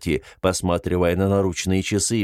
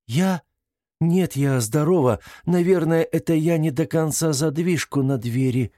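higher voice, defensive and unsure; flustered detained woman; 7 semitones higher than usual. A narrator's reading. Detained woman explains the unlocked door to investigator, flustered. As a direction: answer as a woman caught off guard.

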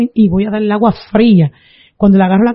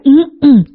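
imitated "mm-mm" of refusal, pitched up; closed-mouth refusal grunt; 5 semitones higher than usual. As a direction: grunt a no with lips shut. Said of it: "y ella decía". (gemido de negativa imitado)

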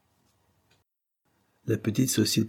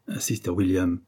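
plain reading voice

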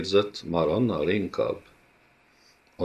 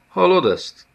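much higher, louder taunt; mocking villain; 12 semitones higher, 6 dB louder than usual. A narrator's reading. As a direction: relish the soldiers' jeers. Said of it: "kérdezte a Szmirdég úr". (gúnyos gonosz úr)

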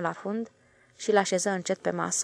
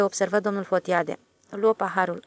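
ordinary delivery, nothing marked

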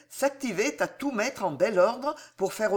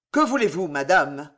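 rudement; 3 semitones lower, 5 dB louder than usual. Acted. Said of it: "Rudement, il demanda".

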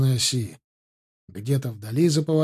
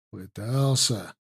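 bitter smirk; 4 semitones lower, 5 dB quieter, slower than usual. A narrator's reading. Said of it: "горько усмехнулся Клим".

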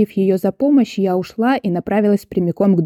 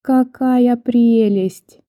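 very slow, higher, drawn-out cooing; gushing adoration; 5 semitones higher, much slower than usual. A narrator's reading. Visitor admires a stranger's old dog, gushingly adoring. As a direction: stretch it out, adoring and sweet. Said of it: "как можно умильнее глядя на собаку".

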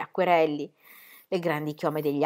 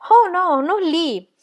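high, louder, urgent plea; anxious high-pitched protest; pleading; 10 semitones higher, 6 dB louder, much slower than usual. A narrator's reading. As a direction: beg them to stop, pitched well up. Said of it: "la pregò Ingeborg".

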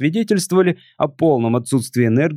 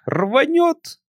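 tipsy alien voice. Acted.

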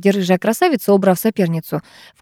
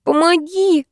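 desperate cry for help; 8 semitones higher, slower than usual. A narrator's reading. Vera hanging over abyss.

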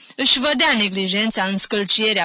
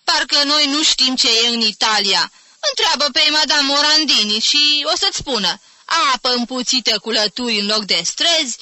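put-on higher, louder scornful old woman; sour scornful aunt; 5 semitones higher, 5 dB louder than usual. A narrator's reading. Sour aunt ranting to nephew about Italy, scornful. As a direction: scoff at everything Italian.

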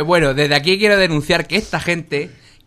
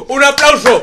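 loud, high cheer; excited shout; 10 semitones higher, 8 dB louder than usual. Real, not acted. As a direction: call out for applause. Calling for applause.

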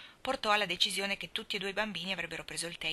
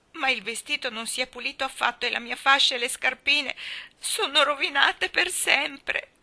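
put-on high, loud tearful little girl's wail; a small girl complaining through tears; 7 semitones higher, 6 dB louder than usual. A narrator's reading. Dora wailing to the ladies about Davy, tearful. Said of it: "gemette Dora".

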